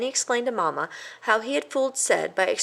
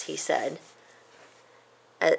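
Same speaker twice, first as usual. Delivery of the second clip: ordinary delivery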